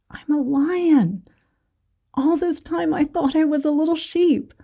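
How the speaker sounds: the cub finally realizing what he really is; dawning realization. Acted.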